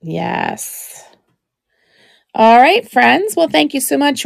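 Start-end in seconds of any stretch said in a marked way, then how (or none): 2.3-4.2 cheerful sign-off. Real, not acted.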